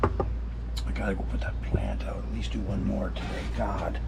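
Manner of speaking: hushed whisper while working outside; whispered reminder to himself. Real, not acted. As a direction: keep it down to a breathy whisper. Heading outside quietly to plants.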